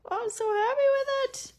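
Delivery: high, fast delighted squeal; excited. Real, not acted. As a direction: gush with real delight. Delighted with finished piece.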